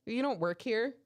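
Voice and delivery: mildly scolding; retold telling-off. Host scolds a comedian behind the bar, retold telling-off. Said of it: "I had to yell at him".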